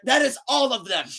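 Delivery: high, loud frustrated repeat; exasperated. Retold or imitated. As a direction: stress it, fed up.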